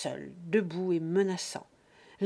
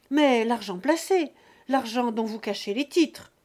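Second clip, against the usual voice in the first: louder, higher pushing tone; insistent son; 3 semitones higher, 7 dB louder than usual. Acted. Son cornering his father about hidden savings, insistent. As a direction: press the accusation home.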